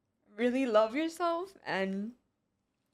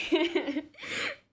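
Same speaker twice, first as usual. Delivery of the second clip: laughing through tears; 7 semitones higher than usual. Real, not acted. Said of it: "I'm gonna wanna cry".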